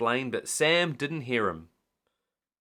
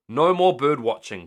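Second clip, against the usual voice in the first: firm declaration; 3 semitones higher, 8 dB louder than usual. A narrator's reading. Firm decision.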